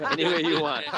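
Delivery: amused, said through laughter; laughing. Real, not acted. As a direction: repeat it while cracking up. Game master laughs at innuendo.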